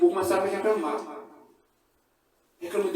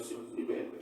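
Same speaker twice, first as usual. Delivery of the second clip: whispered release; 13 dB quieter, faster than usual. Acted. Whispered prayer of forgiveness.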